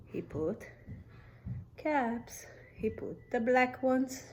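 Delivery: soft whisper while stacking. Acted. Whispering to children while stacking caps. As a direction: hush it, careful and secret.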